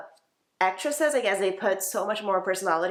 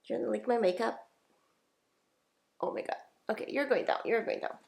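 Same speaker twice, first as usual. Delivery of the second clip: soft, teasing pet talk, softer; cooing at a pet; 2 semitones higher, 5 dB quieter than usual. Real, not acted. Vlogger turns aside to tease her pet, soft playful coo.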